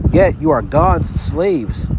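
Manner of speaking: ordinary talk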